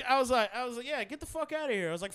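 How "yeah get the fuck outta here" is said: higher, scoffing brush-off; dismissive. Retold or imitated. Teller replaying brushing off the kid, scoffing. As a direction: wave him off with contempt.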